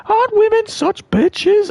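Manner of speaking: very high mock falsetto, slow and sneering; mocking Paul Davis. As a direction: squeak it out slowly like a mocking caricature. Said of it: "Paul's always like".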